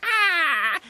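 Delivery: loud, high scream of alarm; high startled yell; frightened yell. A narrator's reading. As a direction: yell "Ah!" in cartoon terror. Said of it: "yelled Nosy".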